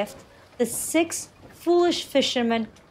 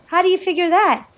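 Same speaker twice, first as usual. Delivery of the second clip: put-on high, quick, louder boy's question; puzzled; 8 semitones higher, 5 dB louder, faster than usual. A narrator's reading. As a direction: fire off a baffled question, pitched up. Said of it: "The boy looked puzzled".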